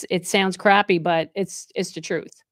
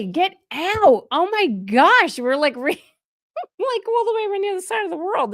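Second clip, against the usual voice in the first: excited disbelief; 10 semitones higher than usual. Real, not acted. Amazed viewer is in Australia.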